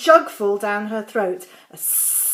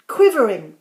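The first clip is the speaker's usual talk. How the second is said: plain reading voice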